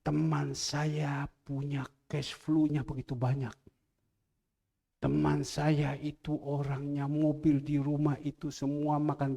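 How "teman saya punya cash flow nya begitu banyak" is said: ordinary delivery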